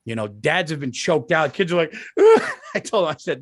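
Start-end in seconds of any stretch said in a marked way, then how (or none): 2.0-2.7 high, loud burst of laughter; real loud laugh. Real, not acted. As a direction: crack up suddenly.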